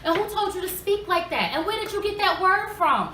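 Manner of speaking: high, loud mock-outraged mother; scolding interrogation. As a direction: fire indignant questions at the kids. Mother retells grilling kids over homework.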